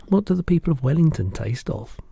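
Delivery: put-on a curious little girl protesting; Sophie's voice.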